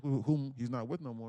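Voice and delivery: ordinary delivery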